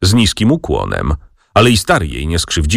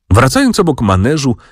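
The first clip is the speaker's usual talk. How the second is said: plain reading voice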